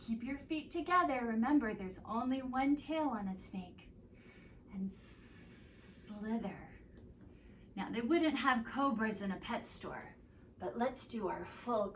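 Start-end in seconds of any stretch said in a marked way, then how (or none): 4.7-6.5 drawn-out slow slither; slow hissy slither. Acted. Yoga teacher showing kids a snake, slow and drawn out. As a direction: stretch the word out like a snake.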